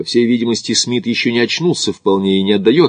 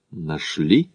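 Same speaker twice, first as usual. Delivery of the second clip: pointed, lower questioning; meaningful query; 4 semitones lower, 5 dB quieter than usual. A narrator's reading. Макдональд queries Смит about the find, meaningfully. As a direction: ask it back with quiet significance. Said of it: "со значением переспросил Макдональд".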